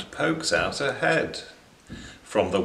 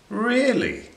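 much higher offended "Really?"; indignant surprise; 11 semitones higher than usual. Acted. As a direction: bristle at the insult. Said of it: "says Blob".

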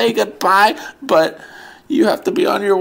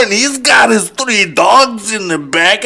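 usual speaking voice

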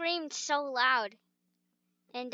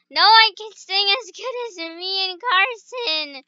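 loud boast; 4 semitones higher, 6 dB louder than usual. Pretend. Bragging singing loudly.

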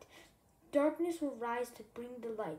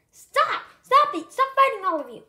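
much higher, much louder shouting to break up a fight; yelling stop; 11 semitones higher, 16 dB louder than usual. Acted. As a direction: scream at them to stop fighting. Friends fighting, someone yells stop.

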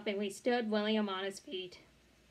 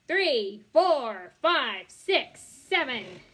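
calling each number out loud; loud counting; 7 dB louder, much slower than usual. A narrator's reading. Teacher counting pull-ups aloud.

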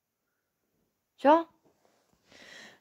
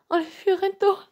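normal talking voice

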